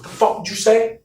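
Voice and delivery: high-pitched incredulous retort; offended challenge. Retold or imitated. Driver turns round on Nick after the insult, offended challenge. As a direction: act out an affronted "say that to me".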